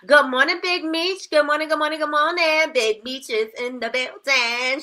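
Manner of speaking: high, singsong good-morning greeting; excited shout-out. Real, not acted. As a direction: hype up a friend who just arrived. Calling out greeting to viewer.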